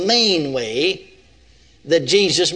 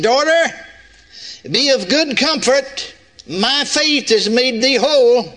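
a hint of Jesus blessing the woman; voicing Jesus; 6 semitones higher, 3 dB louder than usual. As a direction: speak as Jesus, gently solemn. Preacher voicing Jesus in scripture.

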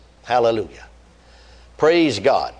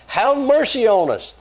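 crying out; 9 semitones higher than usual. Acted. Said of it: "they're asking".

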